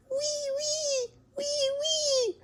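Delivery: very high, soft whistling snore; whistle-snore in a tiny high squeak; snoring whistle. A narrator's reading. Storyteller as Big Mouse snoring, high whistle.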